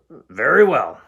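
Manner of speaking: very loud troll boom. Acted. Troll letting the goat pass, booming.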